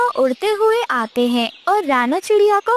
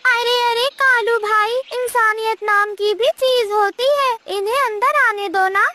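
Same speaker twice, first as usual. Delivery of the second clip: higher, earnest bird voice pleading; pleading parrot; 6 semitones higher than usual. Acted. Parrot pleads with the crow for the chicks, higher voice. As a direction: beg the crow to let them in.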